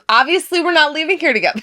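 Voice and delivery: exaggerated high-pitched impersonation; high mocking imitation. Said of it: "She goes".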